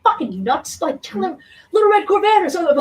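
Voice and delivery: frantic inner-voice nag, fast and high. Retold or imitated. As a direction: berate yourself in a shrieking head voice. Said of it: "my inner voice goes".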